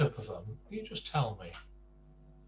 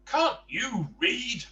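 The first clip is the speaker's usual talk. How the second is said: higher, slow rude grumbler; grumpy Mister Rude; 11 semitones higher, 5 dB louder, slower than usual. Acted. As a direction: sneer it slowly at the visitor. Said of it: "said Mister Rude".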